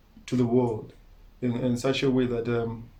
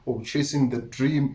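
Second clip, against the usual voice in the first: ordinary delivery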